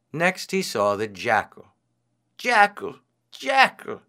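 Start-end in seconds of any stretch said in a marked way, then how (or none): 2.4-4.0 high-pitched storybook call. A narrator's reading. Hungry priest hailing the jackal, calling out. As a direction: hail the animal, high and rising in force. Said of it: "he cried".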